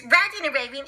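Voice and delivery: plain reading voice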